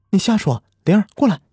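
high, urgent calling out; loud denial. A narrator's reading. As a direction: refuse it and call the child. Mother refuses news, calls daughter.

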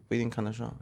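ordinary talk, nothing marked

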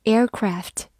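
"aircraft" in an American accent, r sounded, flat-a "craft"; American pronunciation. Shown as a demonstration. Teacher shows learners the American version, "aircraft" in American pronunciation. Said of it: "美式发音".

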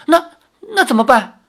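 anxious, frightened plea; trembling voice. A narrator's reading. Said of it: "赵灵儿颤声说".

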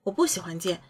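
usual speaking voice

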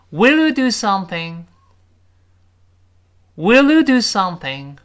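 "you" and "do" punched, pitched high; stress on YOU and DO. Shown as a demonstration. Teacher modelling for students to repeat, emphatic "you" and "do". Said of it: "you和do something那个do要重读".

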